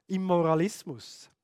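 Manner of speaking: normal talking voice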